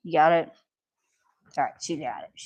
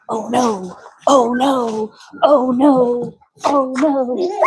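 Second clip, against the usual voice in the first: higher each time, playful; rising mock alarm; 6 semitones higher, 6 dB louder, slower than usual. Real, not acted. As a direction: build it up like a mock panic. Dogs swarming, rising pitch.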